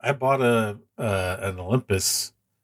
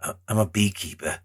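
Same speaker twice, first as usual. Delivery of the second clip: put-on Jason Statham accent. Host mocks Jason Statham's beekeeper line in his accent. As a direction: mumble it like a hard working man from London. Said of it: "Jason Statham accent".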